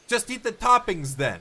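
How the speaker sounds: shout. Acted. Shouted retort.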